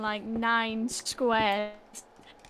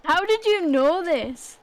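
amazed; 6 semitones higher, 5 dB louder, slower than usual. Real, not acted. Amazed at friend's knowledge.

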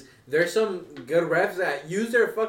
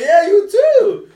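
much higher, louder, slow and insincere; hammed-up fake politeness; fake reply; 15 dB louder, much slower than usual. Lying to a chubby old teammate, high and drawn out. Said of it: "I was hella lying".

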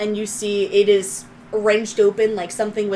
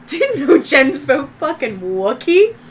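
amazed; 4 semitones higher, 6 dB louder than usual. Acted. GM voicing amazed onlookers.

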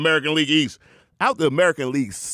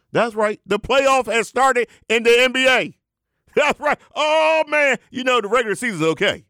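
high, excited sermon shout; hyped preacher; 10 semitones higher than usual. Acted. The Rev hails the NBA playoffs, high hyped preaching. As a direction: whoop it up from the pulpit.

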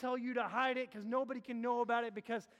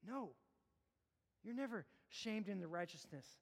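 quiet sermon; 3 semitones lower, 7 dB quieter than usual. Acted. Quiet reflective stretch.

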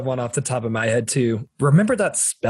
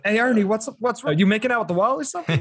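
high, fast taunting voice; mocking bully voice; 7 semitones higher, faster than usual. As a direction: tease him quickly in a higher voice. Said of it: "he's like".

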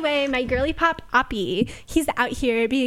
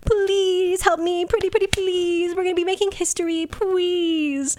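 higher, whiny begging voice; mock pleading; 7 semitones higher, slower than usual. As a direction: beg like a needy child, pretty please. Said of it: "and he's like".

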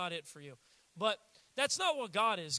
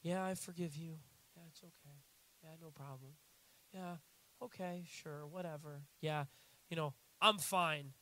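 lower, soft grudging "fine"; passive-aggressive sulk; 5 semitones lower, 6 dB quieter than usual. Retold or imitated. Needy partner grudgingly forgiving while sulking. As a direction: sulk while pretending it's okay. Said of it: "weird girlfriend or boyfriend".

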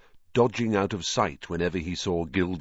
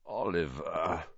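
soft, sleepy croak; low-energy morning croak; croaky; 3 semitones lower, 7 dB quieter, slower than usual. A narrator's reading. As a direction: croak it quietly, barely awake. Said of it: "Harry croaked".

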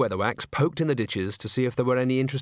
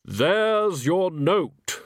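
Death voice; 8 semitones higher, 4 dB louder, much slower than usual. Acted.

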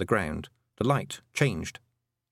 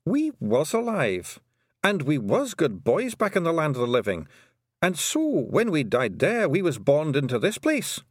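higher-pitched dialect character voice; Rob Anybody, earnest little Feegle; 5 semitones higher than usual. Acted. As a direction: explain it proudly, pitched up.

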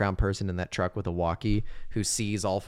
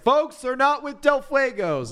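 high, loud, slow announcing; hammed-up high public call; mock announcement; 5 dB louder, slower than usual. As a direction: announce it to the whole diner. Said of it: "It's like".

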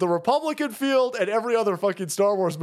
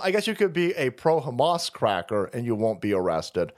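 normal talking voice

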